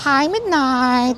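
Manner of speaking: very high, slow singsong hello; cooing pet greeting. Real, not acted. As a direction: stretch out a sweet hello to the cat. Greets her cat at the hospital.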